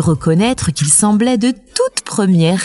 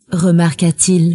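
ordinary delivery

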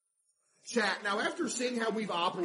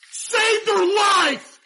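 much higher, loud, slow yell; dramatic shout; 13 semitones higher, 13 dB louder, much slower than usual. Pretend. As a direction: stretch the cry out, hammed-up hero. Customer slips, boss yells.

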